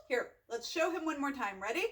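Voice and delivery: plain reading voice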